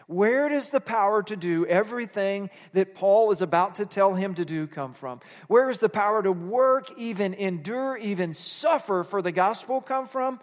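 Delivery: fervent pulpit questions; preaching. Acted. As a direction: press the question on the crowd. Preaching builds.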